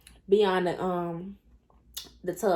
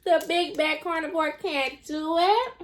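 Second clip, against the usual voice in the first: mock taunt pitched up, relishing it; sarcastic taunt, higher; 7 semitones higher than usual. [name] relishing mocking Jen, sing-song sarcastic taunt. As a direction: tease like a smug villain. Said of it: "being so sarcastic".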